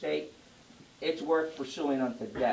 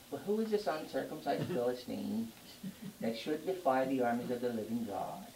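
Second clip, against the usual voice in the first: limp mild reading; 5 semitones lower, 6 dB quieter than usual. Retold or imitated. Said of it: "You think David said it like this?".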